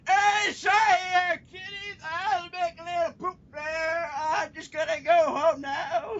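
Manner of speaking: very slow, high, hammed-up old geezer; drawn-out senile old-timer voice; old man impression. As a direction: quaver it out like a doddering old man. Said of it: "[name] is an old man".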